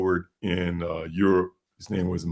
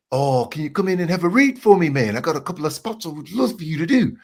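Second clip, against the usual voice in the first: high-pitched mock New Zealand accent; imitated New Zealand lilt, pitched up; Kiwi accent; 7 semitones higher than usual. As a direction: wheedle a favour as a Kiwi. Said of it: "talk like this, eh, way up here".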